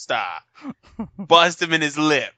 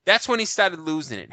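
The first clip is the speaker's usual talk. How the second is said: normal talking voice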